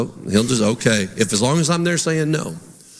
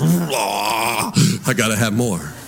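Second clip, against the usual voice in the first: craving voice; 5 semitones lower, much slower than usual. Retold or imitated. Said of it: "you just".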